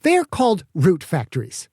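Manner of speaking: mild irritated snap; irritated correction. Acted. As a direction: set the governor straight. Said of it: "Petra snapped".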